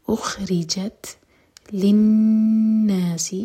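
اخرجت للناس intoned slowly, the final ت breathy and the noon nasal; slow recitation. Shown as a demonstration. Teacher showing breath on the ta, slow chant. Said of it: "ہم سانس جاری رکھیں گے".

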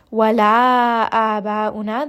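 Wala aba'una with the vowels held long; long mad. Shown as a demonstration. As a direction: stretch the mad to four counts. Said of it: "This is going to be a four count mad".

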